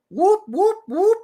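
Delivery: high, loud siren-like whoops; quick rising whoop, whoop, whoop; whooping noise. Pretend. Imitates siren over picture of illegals.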